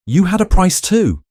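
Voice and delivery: high, loud, cutting; bitter accusation. A narrator's reading. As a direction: fling the accusation at him. Helena accusing Gideon.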